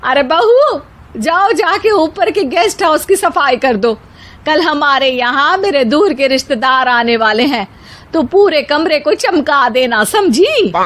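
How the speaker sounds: put-on high, commanding old woman voice; bossy mother-in-law. A narrator's reading. Mother-in-law orders the bahus to clean the guest room, commanding. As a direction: give orders firmly. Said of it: "सास बहुओं से कहती है".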